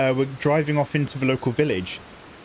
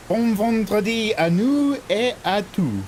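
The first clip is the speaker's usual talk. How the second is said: hammed-up French; 7 semitones higher, slower than usual. Hammed French greeting to chat.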